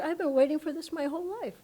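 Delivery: high, rushed retold outburst; overwhelmed. Man fallen off the bed in bliss, mildly overwhelmed. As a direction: blurt it high and fast, lightly acted.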